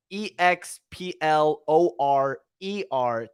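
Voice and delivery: each letter of E X P L O R E R spelled out slowly and evenly. Shown as a demonstration. Host spelling the password for viewers, slow letters. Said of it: "enter the password Explorer".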